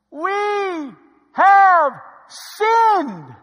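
drawn-out loud cry, word by word; loud confession. Acted. People confessing to Moses, loud drawn-out confession. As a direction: stretch each word, high and ringing.

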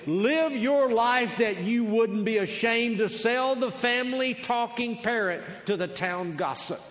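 loud, high declaimed quotation; full-force preaching. Real, not acted. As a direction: proclaim the saying from the pulpit. Delivers the quote in preaching voice.

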